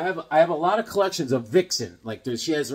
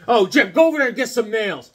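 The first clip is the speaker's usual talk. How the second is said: put-on bossy older kids; 7 semitones higher, 7 dB louder than usual. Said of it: "they was like".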